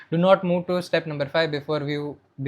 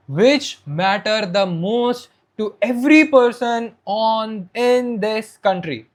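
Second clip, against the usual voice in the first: loud, raised, emphatic stage voice; loud, high-energy; 4 semitones higher, 5 dB louder, slower than usual. Shown as a demonstration. Coach shows how to pass energy to the audience, loud. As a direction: project slowly to every person out there. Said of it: "you need to speak loud, as loud as you can be".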